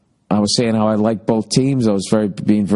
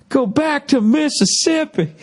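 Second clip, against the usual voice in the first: high, slow drawling heckler; imitating a yelling heckler; mock heckler yell; slower than usual. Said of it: "yelled out".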